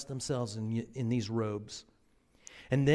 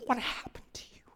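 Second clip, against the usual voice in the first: soft, breathy, quick concern; whispered question; 8 semitones higher, 6 dB quieter, faster than usual. Acted. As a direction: ask gently under the breath. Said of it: "and he says".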